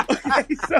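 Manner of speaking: laughing. Real, not acted. Still laughing.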